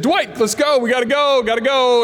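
high, hurried plea to leave; nervous high call to a friend to leave; urgent get out. Retold or imitated. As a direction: call your buddy to get out now. Urgent escape from the house.

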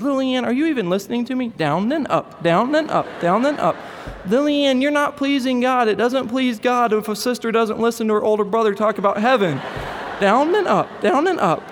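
put-on high, fast, frustrated little boy scolding; exasperated little boy. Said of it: "and he said".

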